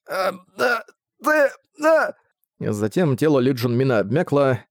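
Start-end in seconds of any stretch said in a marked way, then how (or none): 0.1-2.2 strangled high broken words; choking. Acted. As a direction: gasp the last words out. Guard's last choked words.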